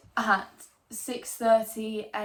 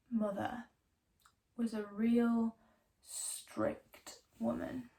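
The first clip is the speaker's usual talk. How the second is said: whispering; 5 dB quieter than usual. Acted. Hushed memory of mother.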